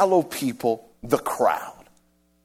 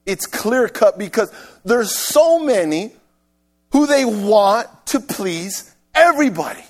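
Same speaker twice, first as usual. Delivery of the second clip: loud, higher, emphatic sermon voice; forceful preaching; 7 semitones higher, 6 dB louder than usual. Real, not acted. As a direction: preach it hard to the congregation.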